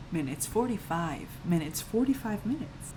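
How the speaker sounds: soft, disbelieving repetition; stunned hush. Real, not acted. Storyteller fixated on the time left, stunned hush. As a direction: repeat it quietly, as if in shock.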